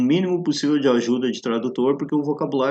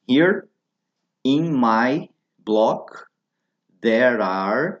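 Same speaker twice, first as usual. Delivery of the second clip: slow model phrase; 4 dB louder, much slower than usual. Shown as a demonstration. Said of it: "Vai dizer assim".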